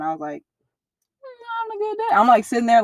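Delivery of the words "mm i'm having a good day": high, sing-song pretend that everything is fine; fake cheery denial. To his worried mom while crying, fake cheerful deflection. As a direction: brush it off with a forced bright lilt. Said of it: "like, crying".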